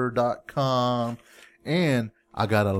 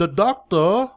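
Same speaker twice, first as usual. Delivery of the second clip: higher, louder mock English accent; bad English accent; 6 semitones higher, 5 dB louder than usual. Giving puzzled spouse another sample, bad English accent. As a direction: overdo a clumsy English accent. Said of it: "your worst English accent ever".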